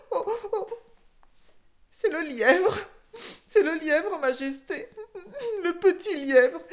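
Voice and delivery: high, loud fake wailing; sobbing snitch warthog. A narrator's reading. The warthog names the hare to the king, wailing high. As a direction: tattle through crocodile tears.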